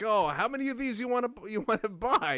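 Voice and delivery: a hint of a spaced-out teenage cashier; stoned clerk. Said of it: "this young kid who's totally stoned".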